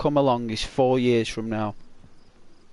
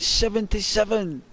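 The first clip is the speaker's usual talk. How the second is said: "seventy-seven?" pitched higher in disbelief; incredulous; 7 semitones higher than usual. Retold or imitated. Teen hearing granddad's age, incredulous "seventy-seven?". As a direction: question "seventy-seven?" like you can't believe it.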